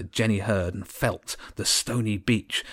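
plain reading voice

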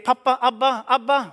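high, loud excited shouting; children calling out. Acted. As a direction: yell for papa as he comes home. Boys calling out to father.

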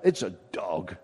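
son-in-law's dismissal. Retold or imitated. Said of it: "My son-in-law says".

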